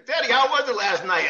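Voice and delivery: high sing-song calls of Teddy; mock announcers calling out. Broadcasters greeting the ref, sing-song and high. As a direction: call his name up high, teasing. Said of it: "they're going".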